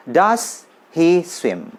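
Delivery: slow, each word apart: "das hi swim"; "Does he swim" spelled out slowly, full S in does; slow drill reading; slow careful reading. Shown as a demonstration. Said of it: "Kalau cepat bacanya".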